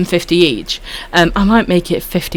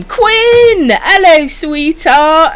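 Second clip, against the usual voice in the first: excited high greeting; 10 semitones higher, 3 dB louder, much slower than usual. Real, not acted. Greeting a new viewer in chat.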